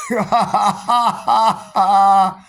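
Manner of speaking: staged laughter exercise; laughing yoga. Shown as a demonstration. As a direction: laugh it out on purpose. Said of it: "I believe in laughing yoga to start".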